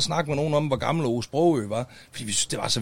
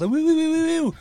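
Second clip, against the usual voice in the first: high squeaky wiu-wiu noise; mock siren squeak; imitated high wiu sound; 4 dB louder than usual. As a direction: pipe it up high like a little alarm.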